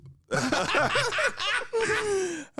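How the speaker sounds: long, high peal of laughter; laughing. Real, not acted. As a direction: laugh hard and let it fall away. Laughs during podcast banter.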